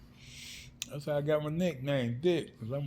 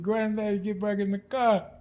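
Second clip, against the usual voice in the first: put-on higher-pitched little kid pleading; little child's voice; 6 semitones higher, 4 dB louder than usual. Young grandson to granddaddy out of the car, little child's voice. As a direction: imitate your small self, pitched up. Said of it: "if my little voice".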